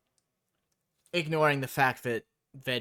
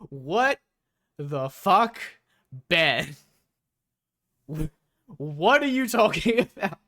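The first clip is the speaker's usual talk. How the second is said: loud, high disbelieving yell with a laugh; shocked outburst; 5 semitones higher, 6 dB louder than usual. Real, not acted. Reacting to Ben's outrageous remark, loud shocked laughing yell. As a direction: blurt it out in sheer disbelief.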